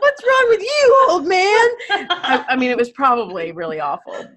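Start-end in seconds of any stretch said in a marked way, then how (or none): 0.0-1.7 high, loud mock outrage; mock indignant comeback. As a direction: throw it out loud and high, as if telling someone off. Acting out her loud comeback.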